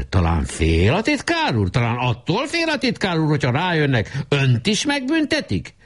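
rising, pointed questioning; stern. A narrator's reading. The president sternly questions the secretary, rising. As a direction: press him sternly. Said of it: "Bej szigorúan kérdezte".

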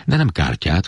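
ordinary delivery

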